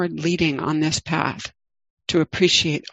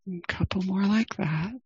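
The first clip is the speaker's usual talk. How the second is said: guided meditation. Acted. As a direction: ease listeners into the body, gently.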